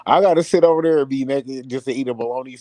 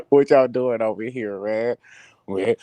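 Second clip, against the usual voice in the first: ordinary talk, nothing marked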